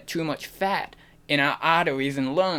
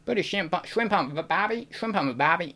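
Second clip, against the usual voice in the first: higher-pitched, tentative Australian accent; 4 semitones higher, 4 dB quieter than usual. Shown as a demonstration. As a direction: have a go at Australian.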